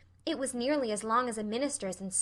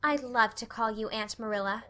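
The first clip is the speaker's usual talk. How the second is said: plain reading voice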